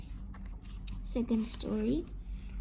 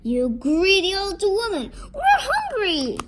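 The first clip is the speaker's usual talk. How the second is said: loud, high yell climbing higher; angry shouting; 7 semitones higher, 8 dB louder than usual. A narrator's reading. Hungry girl scolds the witch who took the basket, angry shouting. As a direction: shout it, pitch rising to a squeal. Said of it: "Kate shouted at her some more".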